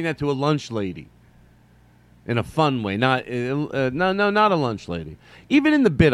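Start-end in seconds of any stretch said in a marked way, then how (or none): 5.5-6.1 loud, high burst; emphatic outburst. Real, not acted. Comic insists on being right, loud and high. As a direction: blurt it out insistently. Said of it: "sudden loud outburst".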